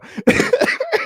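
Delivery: airy wheezing laugh; breathy high laugh. Real, not acted. Streamer cracking up at a viewer's comment, breathy laugh. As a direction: crack up at the joke.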